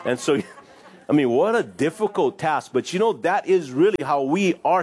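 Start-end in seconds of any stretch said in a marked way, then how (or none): none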